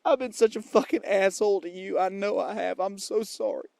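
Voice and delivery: put-on cowboy accent. Apologizing to fans and Amanda, in fake Texan accent. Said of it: "I don't even have an accent".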